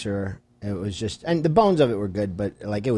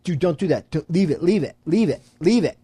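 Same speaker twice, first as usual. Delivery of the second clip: urgent repeated plea, rising higher; retold insistent plea; 4 semitones higher, 3 dB louder than usual. Telling a friend to stop kicking it, insistent. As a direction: keep warning a friend off, building higher and louder.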